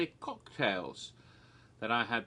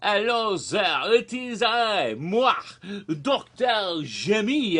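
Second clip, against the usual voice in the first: put-on loud, high, slow French-accented flourish; fake French accent; 4 semitones higher, 5 dB louder, slower than usual. Host introducing himself grandly in a fake French accent. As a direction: announce yourself as a hammy Frenchman, French accent. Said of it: "this is the wrong fake accent".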